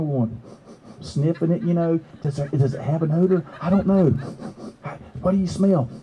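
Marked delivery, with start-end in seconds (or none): none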